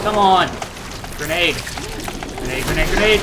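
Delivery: frantic shouting grenade; grenade warning yell. Real, not acted. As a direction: yell it urgently mid-fight. Frantic fight, throwing grenades.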